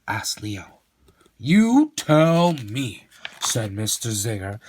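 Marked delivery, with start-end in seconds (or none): 1.4-3.1 slow teasing. Acted.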